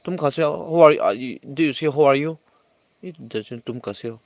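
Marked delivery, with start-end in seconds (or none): none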